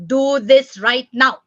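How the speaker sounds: loud, slow, weighty order; demanding parent. Shown as a demonstration. Parent ordering a child to obey at once, demanding. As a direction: order it firmly, word by word.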